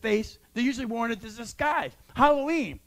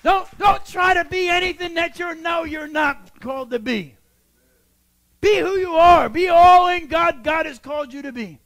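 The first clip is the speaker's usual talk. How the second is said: loud, high preacher's shout; fiery sermon call; preaching at full force; 6 semitones higher, 9 dB louder than usual. Acted. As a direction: drive it home to the congregation. Preaching climax.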